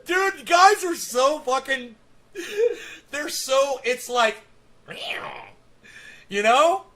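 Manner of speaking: high, slow laugh-crying. Real, not acted. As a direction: talk through tears of laughter. Emotional after laughing.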